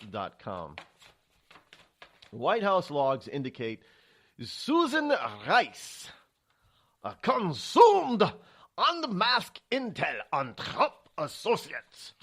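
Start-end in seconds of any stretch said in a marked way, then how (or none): 4.4-12.1 put-on slow, hammy German accent; bad German accent. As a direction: read it in an over-the-top German accent. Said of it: "my very bad German accent".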